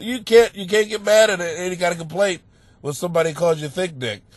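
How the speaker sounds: ordinary talk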